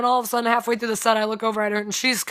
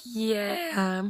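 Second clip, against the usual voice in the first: shy mumble; 7 dB quieter than usual. Retold or imitated. Silent sister answering the band, shy mumble. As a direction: barely give them a word.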